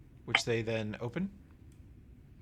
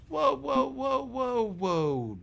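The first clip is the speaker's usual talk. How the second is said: much higher, alarmed whoas that settle back down; startled whoa; 13 semitones higher, 5 dB louder, much slower than usual. Acted. Tram protests Bam's accusation with high, alarmed whoas. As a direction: hold up, protesting high and fast. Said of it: "She goes".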